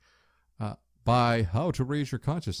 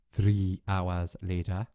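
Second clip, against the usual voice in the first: slow narrator; 5 semitones lower, much slower than usual. Pretend. Mock narrator time card.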